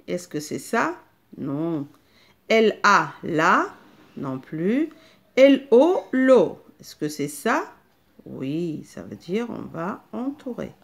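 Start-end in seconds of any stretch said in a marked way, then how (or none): 5.4-6.0 L O called out loud and higher; spelled-out syllable. Shown as a demonstration. Teacher spells a syllable for children, loud. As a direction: spell the letters out crisply. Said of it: "je vais faire le premier exemple avec vous".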